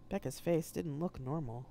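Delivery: ordinary delivery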